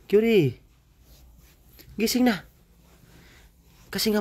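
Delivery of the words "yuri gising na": calling out. Real, not acted. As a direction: call her name, loud and high. Calling child to wake up.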